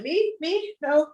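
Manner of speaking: squeaky high disbelief; a giddy high-pitched me? me?; excited squeak. Retold or imitated. Telling the crowd about seeing a woman, high squeak. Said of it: "I'm like".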